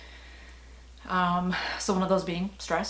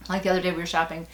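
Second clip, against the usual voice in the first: usual speaking voice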